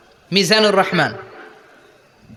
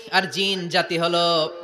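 low, softer measured chant; intoned sermon; 3 semitones lower, 4 dB quieter than usual. Acted. Preacher voicing idolaters asking what God is made of, low sermon chant. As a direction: bring the challenge down to a low intoned line.

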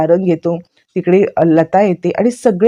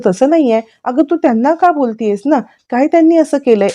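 angry protest; 8 semitones higher than usual. Retold or imitated. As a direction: push back hard at grandma. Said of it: "सारंगला खूप राग येतो".

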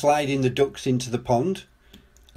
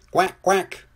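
two short, high quacks; duck quacks; 6 semitones higher, 4 dB louder than usual. A narrator's reading. Two little ducks waddle up to Muck, high quacking.

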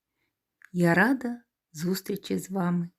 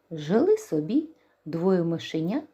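ordinary delivery

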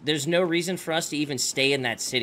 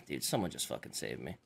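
defeated groan; 14 semitones lower, 13 dB quieter than usual. Real, not acted. Defeated after death.

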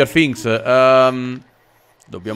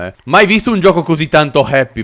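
ordinary delivery

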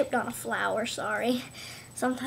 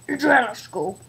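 pretend dad; 5 dB louder than usual. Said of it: "I'm Adriana's dad".

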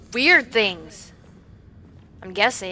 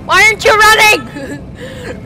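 slow, high, crying wail; a frightened cry, higher and louder; panicked wail; 9 semitones higher, 5 dB louder, slower than usual. Real, not acted. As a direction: plead in real panic, almost sobbing. Character won't run, panic.

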